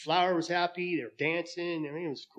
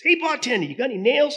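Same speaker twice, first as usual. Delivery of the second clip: very high, louder cartoon duck; 11 semitones higher, 7 dB louder than usual. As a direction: pipe it up like a sly duck. Duck asks about nails.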